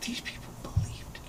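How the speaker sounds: soft unvoiced whisper. Real, not acted. Telling friend the men believed it, whispered. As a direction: breathe it out in disbelief.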